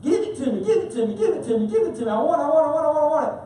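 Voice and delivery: put-on high, demanding toddler voice; whiny two-year-old. A preacher voicing the greedy toddler inside us. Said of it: "That little two-year-old that says".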